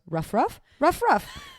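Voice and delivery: put-on playful toddler "ruff ruff" rising high; toddler barking. Mom imitating her son barking at the dog stockings, playful. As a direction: bark like a little kid playing dog.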